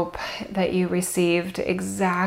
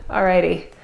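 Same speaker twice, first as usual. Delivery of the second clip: normal talking voice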